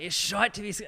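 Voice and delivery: high-pitched Scottish catchphrase, cut short; imitated Scottish accent. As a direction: mimic an actor warming up his Scottish accent. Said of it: "he'd be backstage just like".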